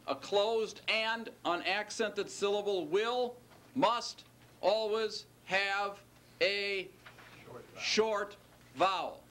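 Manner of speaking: "must, always have a short vowel" spelled out slowly, each word hit; slow it right down and lean on "must" and "always"; slow emphatic rule. Shown as a demonstration. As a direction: drive the rule home word by word. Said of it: "if there's one rule that holds".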